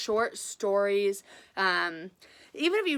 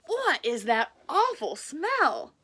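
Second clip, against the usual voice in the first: cow voice; 5 semitones higher, 3 dB louder, much slower than usual. Acted.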